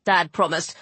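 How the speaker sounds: ordinary delivery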